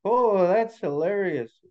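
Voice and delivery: high, sing-song storybook ridicule; teasing crowd voice, incredulous; mocking amusement. A narrator's reading. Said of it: "started laughing to themselves and pointing".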